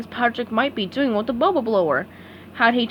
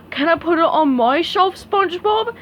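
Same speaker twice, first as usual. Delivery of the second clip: Patrick voice; 6 semitones higher, 4 dB louder than usual. A narrator's reading. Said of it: "he asked".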